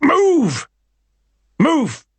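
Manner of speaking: high, loud barked order; ordered shout. A narrator's reading. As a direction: shout it at the stubborn dog. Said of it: "ordered Harry".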